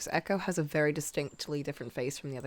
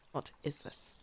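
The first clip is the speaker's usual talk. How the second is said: ordinary delivery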